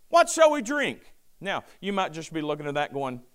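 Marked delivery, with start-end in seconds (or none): 0.1-1.1 mock whine. Israelites grumbling.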